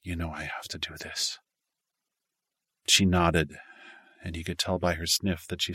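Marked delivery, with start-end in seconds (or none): 0.0-1.4 whispered. Acted. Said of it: "whispered in her ear".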